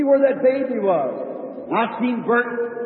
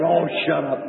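curt dismissal; 8 semitones lower than usual. Retold or imitated. Bert brushing off the preacher.

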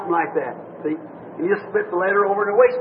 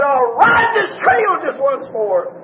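crying out; 2 semitones higher, slower than usual. Retold or imitated. The rider on the rock crying out his last vow, slow shout. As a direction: call it out over the valley, drawn out.

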